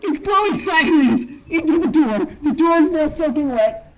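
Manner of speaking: loud and high over the rain wind. Real, not acted. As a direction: call it out over the storm.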